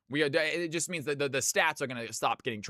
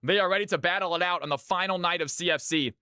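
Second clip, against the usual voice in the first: higher, building caster call of the draft; hype caster play-by-play; 5 semitones higher than usual. Acted. Casting the final CFC night to the stream, hype play-by-play. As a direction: call the matchup like a tournament caster.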